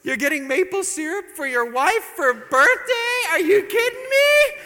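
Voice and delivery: loud, high, slow indignant wife's cry; wife shrieking at a birthday gift of syrup; outraged wife. Retold or imitated. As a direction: blast him with disbelief.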